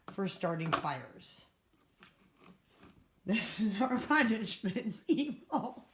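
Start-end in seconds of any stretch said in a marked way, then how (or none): 3.3-5.8 laughing. Real, not acted. Bitter joke about power cuts.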